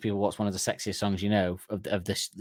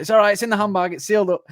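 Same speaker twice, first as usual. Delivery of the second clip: high, louder breezy reassurance; mock girlfriend, high and chirpy; high breezy girl; 10 semitones higher, 6 dB louder than usual. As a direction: brush it off in a high voice. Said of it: "She's like".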